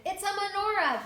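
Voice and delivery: high, loud firm retort; firm reply. A narrator's reading. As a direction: answer just as firmly. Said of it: "replied as firmly".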